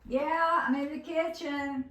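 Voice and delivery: calling back from the kitchen; from another room. Real, not acted. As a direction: answer from the next room.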